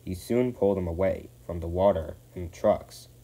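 ordinary delivery

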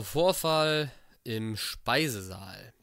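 slow, deliberate title read; reading aloud. Acted. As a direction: announce the document heading. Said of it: "reads document title". (langsam vorgelesen)

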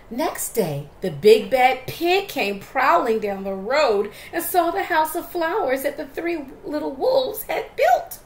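loud, building suspense; dramatic storytelling. A narrator's reading. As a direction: lean into the menace. Menacing story narration.

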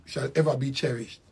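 ordinary delivery

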